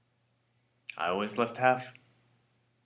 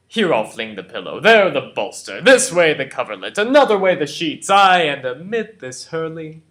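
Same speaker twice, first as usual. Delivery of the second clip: building loud and high; boisterous ranting; 6 semitones higher, 9 dB louder than usual. Acted. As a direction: hurl each item louder than the last. Petruchio acting out the bed-tossing.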